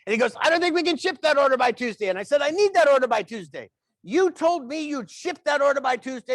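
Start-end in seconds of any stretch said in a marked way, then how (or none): 0.4-2.1 loud, high, oblivious caller; loud phone talker. Retold or imitated. Phone talker repeats it right beside him, really loud. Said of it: "people talk really loud". 2.4-6.3 raised, high, indignant shout; mock angry demand. Bystander butting into stranger's phone call, mock angry.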